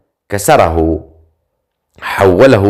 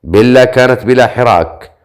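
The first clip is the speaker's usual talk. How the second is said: usual speaking voice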